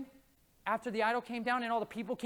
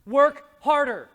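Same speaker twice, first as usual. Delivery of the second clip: 1 forceful command; 9 dB louder, slower than usual. Real, not acted. Exhorting congregation to work harder.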